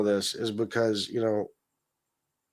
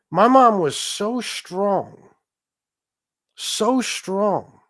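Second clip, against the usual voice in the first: "so" drawn out and higher both times; stress on SO; 6 semitones higher, much slower than usual. Real, not acted. Remembering their late mom, emphatic "so", stretched. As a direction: lean slow and high on "so" in "so strong". Grieving child praising late mom's strength.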